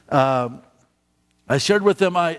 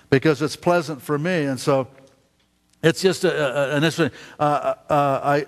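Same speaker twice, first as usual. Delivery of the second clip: usual speaking voice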